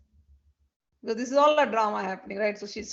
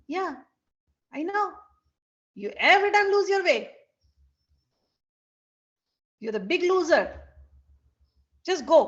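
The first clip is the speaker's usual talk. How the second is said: sharp, higher and louder scolding; angry scolding; 7 semitones higher, 5 dB louder than usual. Acted. Radha scolding late Krishna, angry and loud. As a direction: tell him off, fed up.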